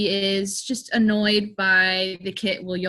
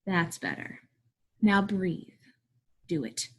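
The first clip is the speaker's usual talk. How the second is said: soft, low, spaced-out orders; hushed menace with pauses between orders; controlled command; 3 semitones lower, 7 dB quieter than usual. Acted. Teacher making kids breathe, quiet restrained command. Teacher reining in class and self.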